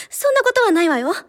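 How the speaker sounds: high-pitched, hasty cover-up; flustered excuse. Acted. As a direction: deny it while trying to save face. Covers up her fear of bugs. (慌てて高い声で取り繕う)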